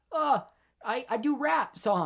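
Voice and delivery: lightly voiced cartoon kangaroo; silly kangaroo voice. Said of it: "the Kangaroo Jack is like".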